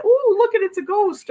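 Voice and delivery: put-on high, loud wide-eyed child; childish little mind. Her little mind spots a ghost, high excited child voice. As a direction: gasp it out like an amazed little kid. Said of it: "my little mind just said".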